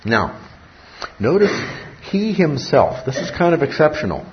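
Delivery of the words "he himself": "he himself" lifted high, then falling; stress on "he himself". Shown as a demonstration. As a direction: lean on "he himself" as an emphatic pronoun. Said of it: "this is an emphatic pronoun".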